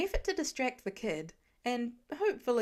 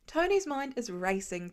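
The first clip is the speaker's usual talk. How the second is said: usual speaking voice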